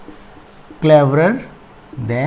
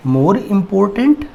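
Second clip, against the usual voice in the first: slow, deliberate dictation; 4 semitones higher, much slower than usual. Shown as a demonstration. Teacher dictating comparative form slowly to students. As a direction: draw it out for students to write. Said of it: "important".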